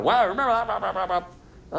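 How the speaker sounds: lightly lampoon a partner bringing up the past; mock nagging spouse. Preacher imitating a spouse reopening an old fight.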